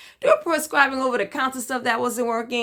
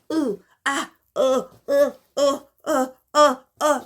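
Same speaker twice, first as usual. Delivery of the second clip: mock groans of pain, rising; pained moaning; 4 semitones higher than usual. Telling her followers about the pain, mock groaning. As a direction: moan through the pain in little bursts. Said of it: "I had a dance for this".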